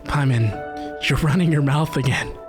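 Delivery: ordinary talk, nothing marked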